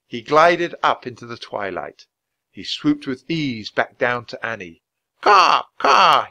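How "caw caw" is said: high, loud cawing; raven's call. A narrator's reading. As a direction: caw out to a friend as thanks. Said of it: "he called".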